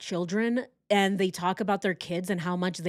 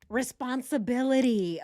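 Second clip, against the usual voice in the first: ordinary talk, nothing marked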